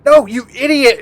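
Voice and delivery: loud, high-pitched rebuke; acted exasperated shout. Game master voices cultist stopping his gullible friend, exasperated shout. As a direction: yell to stop a foolish friend.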